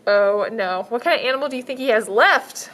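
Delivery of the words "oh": ordinary delivery, nothing marked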